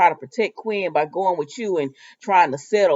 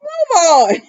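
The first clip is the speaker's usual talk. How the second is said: high, loud sing-song "move on"; mock shout; 10 semitones higher, 7 dB louder than usual. Vlogger telling the characters to move on, high and loud. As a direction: shoo them along in a loud high voice. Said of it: "I said".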